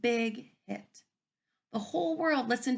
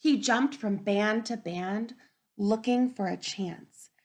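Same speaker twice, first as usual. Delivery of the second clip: story narration; 7 dB louder than usual. Acted. Teacher reading the story aloud to children. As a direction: build the dream with energy.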